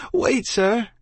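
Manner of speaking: high-pitched urgent plea; child crying out. A narrator's reading. Child being dragged to rowboat, urgent cry. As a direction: call out high to stop him. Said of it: "I cried".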